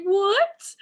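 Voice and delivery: a sharp, high incredulous "What?"; stunned what. Retold or imitated. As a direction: jump up in disbelief on the one word. Retelling her own stunned reaction.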